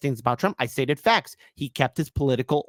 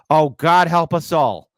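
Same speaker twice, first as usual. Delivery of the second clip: mock dread; 7 dB louder, slower than usual. Real, not acted. Dreading Kamala taking over.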